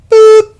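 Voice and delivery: imitated high electronic chime boop; a sudden high "boop" like the air train bell. High mock chime of the air train.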